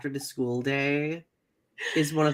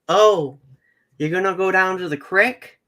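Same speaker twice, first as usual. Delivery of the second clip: put-on higher, louder Michigan accent, "gonna go down" run together; hometown Michigan accent; 5 semitones higher, 7 dB louder than usual. Showing a favorite Michiganism to a friend, in a Michigan accent. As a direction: mimic the hometown Michigan way of asking. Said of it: "we would say".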